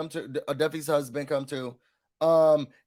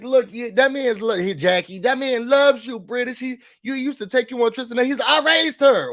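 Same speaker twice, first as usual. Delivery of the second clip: heated retelling; 5 semitones higher, 4 dB louder than usual. Retold or imitated. Acting out a heated confrontation.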